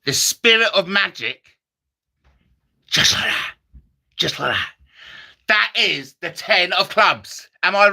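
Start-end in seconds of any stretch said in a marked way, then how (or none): 2.9-4.7 breathy then low catchphrase; a hint of Tommy Cooper. Comic doing Tommy Cooper's catchphrase, low. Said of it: "my Tommy Cooper impression".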